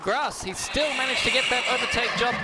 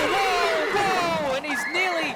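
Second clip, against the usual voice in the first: excited whoas; 6 semitones higher, 3 dB louder, slower than usual. Real, not acted. Co-commentator reacting to the spin.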